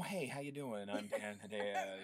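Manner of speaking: tired, weary voice, soft and slow; soft, weary sad-sack drone; Dan Hedaya. Retold or imitated. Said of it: "kind of a tired, weary voice".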